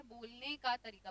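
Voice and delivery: normal talking voice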